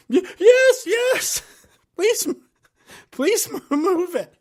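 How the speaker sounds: mock hysterical shout; hysterical pleading. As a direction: beg him through panic and tears, half laughing. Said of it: "she was still hysterical".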